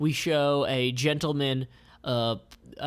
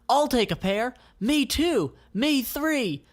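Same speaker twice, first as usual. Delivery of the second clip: high, quick eager chorus; each one jumps in keen; eager buyers; 11 semitones higher, 4 dB louder than usual. Acted. Band members ordering shoes, eager. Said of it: "each of 'em says in turn".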